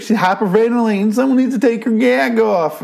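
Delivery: very high-pitched, hammed-up twang accent; mock an overcooked twang accent way up high; Maggie's overdone accent. Podcaster mocking an actress's accent, high exaggerated twang. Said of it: "Maggie kinda overdoses on her accent".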